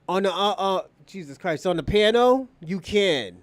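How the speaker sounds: usual speaking voice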